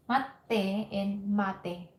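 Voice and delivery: very slow "mate and mate", second with a held stop; tsu contrast. Shown as a demonstration. Teacher repeats the pair slowly to drill the small tsu. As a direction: drag out "mate" then "mate" with the tsu gap. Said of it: "don't forget the tsu".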